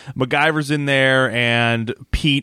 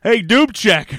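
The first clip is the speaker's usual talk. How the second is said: higher-pitched playful holler; mock calling out; 8 semitones higher, 4 dB louder, slower than usual. Host pretending to call over Dubček, playful calling out. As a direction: hail a buddy in pretend play.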